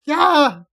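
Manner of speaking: loud, high acted yelp; sudden yelp. Trace reminded of the injured hand, sudden yelp. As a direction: burst out with one startled cry.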